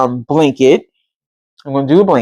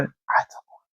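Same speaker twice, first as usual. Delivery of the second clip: breathy whispered confession; sneaky whispered aside to viewers; 13 dB quieter than usual. Real, not acted. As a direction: whisper it like a little secret. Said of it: "unvoiced aside".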